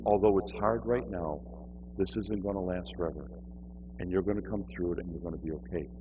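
soft, low, tender comfort; gentle reassurance. Retold or imitated. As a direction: promise it quietly and calmly. Continues comforting message to woman.

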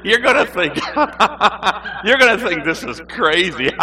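loud, high, mock nervous laughing; nervous laughter. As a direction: burst into awkward laughs between phrases. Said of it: "When I'm nervous, I laugh".

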